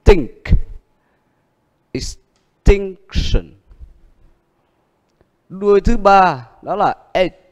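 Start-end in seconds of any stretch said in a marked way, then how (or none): 1.9-3.5 ex-TINC-tion drawn out slowly, falling; slow teacher model of extinction; stress on tinc. Shown as a demonstration. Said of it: "Vậy âm nhấn ở chữ gì?".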